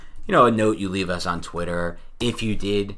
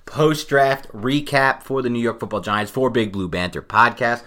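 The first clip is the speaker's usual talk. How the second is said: podcast outro; 2 semitones higher, 6 dB louder than usual. Acted.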